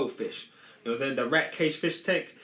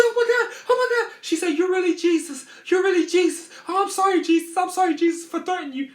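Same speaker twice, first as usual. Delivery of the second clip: much higher and louder, shrieking amazement; astonished mom; 7 dB louder than usual. Retold or imitated. Mom sees the mouse revive, shrieks much higher and louder in awe. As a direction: shriek like you just saw a miracle.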